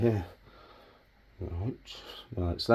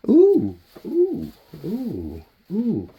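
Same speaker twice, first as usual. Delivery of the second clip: high ooh; 8 semitones higher, 5 dB louder, much slower than usual. Real, not acted. Admiring the bend in the wire.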